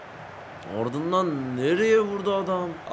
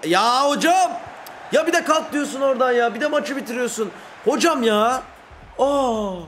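outraged protest; 7 semitones higher than usual. Real, not acted. Protesting the referee.

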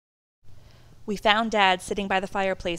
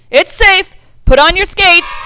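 high, loud holler; far-carrying all-clear shout; called out; 11 semitones higher, 18 dB louder than usual. A narrator's reading. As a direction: shout the good news across the lake. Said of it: "turned to us and called out".

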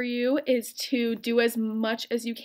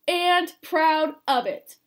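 slow, higher, louder proud cry; proud shout; 5 semitones higher, 6 dB louder, much slower than usual. Acted. Joey on top bar to schoolyard, proud shout. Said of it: "He shouted over the schoolyard".

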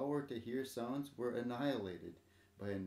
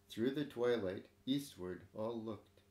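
ordinary delivery, nothing marked

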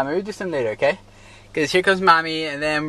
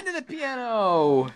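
usual speaking voice

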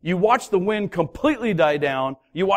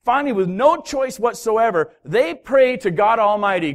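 ordinary talk

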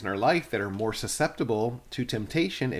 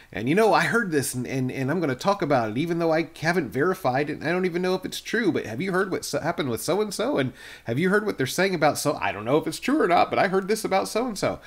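hurried, higher-pitched gossip voice; gossiping churchgoer; 4 semitones higher, faster than usual. Acted. Preacher acting out someone spreading unverified rumours about so-and-so, gossipy. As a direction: rattle it off like a juicy rumour, pitched up.

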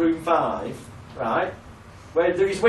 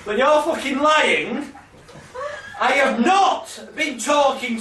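dramatic devil voice; 8 semitones higher than usual. Acted. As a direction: shout it as the devil threatening through the speakers, half cracking up. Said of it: "this really dramatic voice going".

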